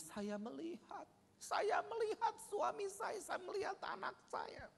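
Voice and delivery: high, soft, slow choked voice; tearful. Retold or imitated. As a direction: voice breaking with grief. Grieving widow's testimony.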